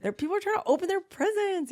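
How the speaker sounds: put-on high-pitched whiny teen pleading; embarrassed daughter. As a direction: beg dad to sit down, mortified. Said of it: "Cruz is like".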